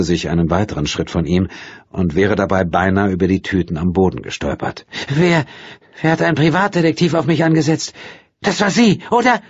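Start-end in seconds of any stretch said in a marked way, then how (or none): 5.1-9.4 high, accusing and fast; angry question. A narrator's reading. Angry woman accusing the detective's client. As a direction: demand it, furious. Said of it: "fragte sie wütend".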